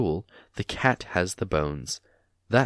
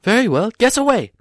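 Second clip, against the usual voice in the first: higher, louder brave reply; bold hobbit; 9 dB louder than usual. A narrator's reading. Bilbo dares Gollum to guess. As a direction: put on courage. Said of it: "said Bilbo".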